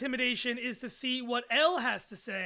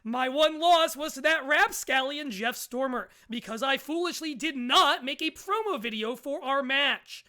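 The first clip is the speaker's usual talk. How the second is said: normal talking voice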